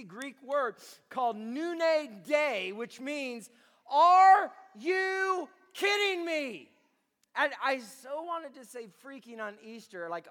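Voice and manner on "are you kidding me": slow, loud, disbelieving shout; exasperated shout. Acted. Preacher booming Paul's disbelief at the church, slow exasperated shout. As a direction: throw the question at the crowd.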